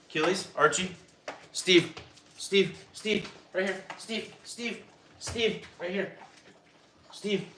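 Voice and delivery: high, sing-song calling of the dogs by name; coaxing dog call. Real, not acted. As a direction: coax the dogs over in a high, gentle call. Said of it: "I'm like the dog whisperer".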